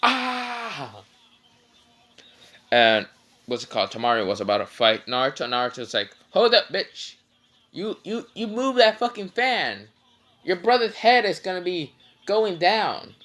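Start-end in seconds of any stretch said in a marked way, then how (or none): 0.0-1.0 long high-pitched scream, falling off at the end; hammed-up cry of pain; mock pained scream. Kankuro with his hand just broken, mock scream. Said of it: "Kankuro just yells". 6.3-13.1 high-pitched, hammed-up warning; mock threat. Naruto warning Tamari off her fan, mock threatening.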